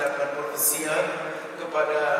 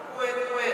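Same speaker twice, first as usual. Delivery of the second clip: high, even quack from afar; imitated duck quack; 7 semitones higher, 4 dB quieter than usual. Preacher mimics a sound heard from afar, imitated quacking. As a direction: imitate a distant duck, higher pitched. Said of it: "terdengar suara".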